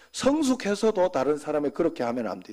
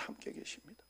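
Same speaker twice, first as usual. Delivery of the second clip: whispered prayer; 22 dB quieter than usual. Shown as a demonstration. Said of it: "하고 하나님을 불러야 돼요". (속삭이는 기도)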